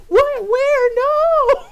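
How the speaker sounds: very high, loud mock panic; imitated freak-out. Brother freaking out at sister's aswang scare, panicked shriek. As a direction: shriek it like a terrified little brother. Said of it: "my brother would freak out".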